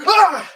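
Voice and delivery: sudden high loud scream of fright; startled shriek. Retold or imitated. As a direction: jump and scream in fear.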